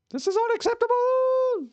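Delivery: very high, held cartoon shriek; Lemon Grabs voice. Complaining to the ISP as Lemon Grabs, shrieking. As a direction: screech it in outrage like a cartoon lemon. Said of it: "in the Lemon Grabs voice".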